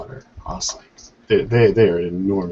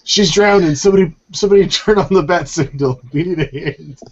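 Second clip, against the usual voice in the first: mock panic; 8 semitones higher, 5 dB louder than usual. Jokes about the manatee swimmer.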